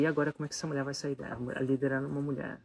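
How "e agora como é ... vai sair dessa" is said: rushed, worried inner question; fast worried wondering; anxious thought. Retold or imitated. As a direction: blurt the worry out fast. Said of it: "e eu falei".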